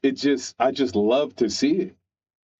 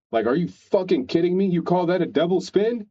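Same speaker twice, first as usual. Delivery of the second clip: high-pitched, incredulous telling-off; mock scolding coach; 7 semitones higher than usual. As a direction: imitate an outraged dance coach. Acted coach berating six-year-old dancer.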